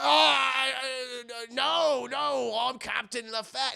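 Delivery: mock flustered denial; flustered fake captain. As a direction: bluff nervously as a pirate. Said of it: "he's like".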